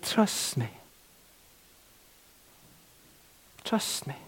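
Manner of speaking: gentle trust me. Retold or imitated. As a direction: say it softly to reassure her. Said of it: "the man of God said".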